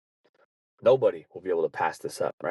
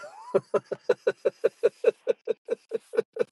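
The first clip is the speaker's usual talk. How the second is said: breathy high laugh; 13 semitones higher than usual. Real, not acted. Prank victim is the boss.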